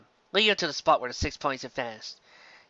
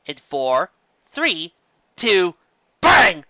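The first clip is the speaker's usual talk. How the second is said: counting down, rising to a loud bang; building countdown; 4 semitones higher, 8 dB louder, much slower than usual. A narrator's reading. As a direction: build each number up to the bang. Narrator counts down to arrival.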